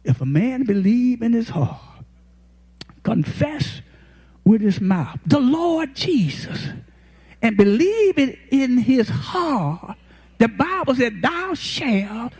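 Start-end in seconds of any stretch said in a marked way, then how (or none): none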